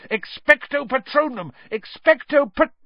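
high, loud spell shout, cut off; yelled spell. Acted. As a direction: keep yelling until you're cut off. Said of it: "Harry yelled".

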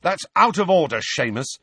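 high, loud reproach; indignant telling-off; rising anger. A narrator's reading. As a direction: rebuke a friend with temper starting. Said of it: "ears were starting to glow red".

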